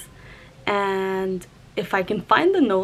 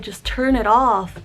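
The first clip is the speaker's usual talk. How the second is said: louder, slow, drawn-out "turn it off"; retold firm insistence; 2 semitones higher, 5 dB louder, slower than usual. Anxious, telling Dad to stop the noise, retold firm insistence. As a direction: lean slowly and firmly on each word.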